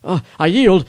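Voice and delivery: shouting surrender from the dirt; desperate shout. Acted. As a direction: beg them to stop, shouting. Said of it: "I heard his shout".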